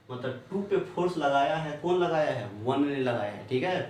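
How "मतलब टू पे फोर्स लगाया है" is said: ordinary delivery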